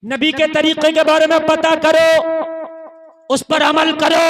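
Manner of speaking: loud preacher's exhortation; loud sermon cry; fiery preaching. Acted. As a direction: thunder it to the crowd. Preacher at full force.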